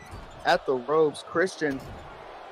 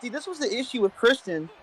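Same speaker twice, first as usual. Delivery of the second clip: raised, lively ringside commentary voice; wrestling commentator; 4 semitones higher than usual. Acted. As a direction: call the match like a commentator. Holds a live play-by-play.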